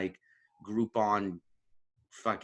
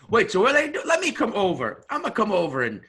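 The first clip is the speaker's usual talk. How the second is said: high, loud excited Isaiah; eager imitation; 8 semitones higher, 6 dB louder, faster than usual. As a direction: rush over, dying to join in. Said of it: "if he was like".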